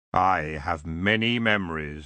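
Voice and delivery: low and unhurried, a courtly greeting; elf king. Acted. Elf king greeting Tiffany, low and lordly.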